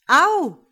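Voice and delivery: loud, high cry of "ow"; sudden high shout of pain; pained yelp. Acted. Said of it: "schreit Zachariella".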